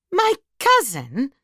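high, drawn-out disbelieving question; slow, high exclamation of disbelief; incredulous shout. A narrator's reading. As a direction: stretch it out in scornful disbelief. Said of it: "she shouted".

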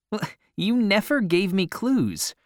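high-pitched playful protest; object, pitch jumping up high. A narrator's reading. Narrator's character protests to boy.

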